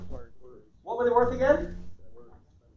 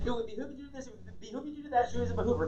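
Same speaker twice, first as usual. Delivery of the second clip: mock prim lady; 9 dB quieter than usual. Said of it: "This other lady said".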